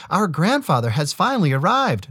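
high, bright sister voice; calm big sister announcing; young woman voice. A narrator's reading. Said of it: "Nolka said".

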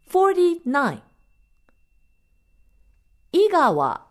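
high, innocent doll voice; talking doll. A narrator's reading. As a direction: chant names in a clear, childlike voice. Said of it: "The doll's innocent voice was clear as bell".

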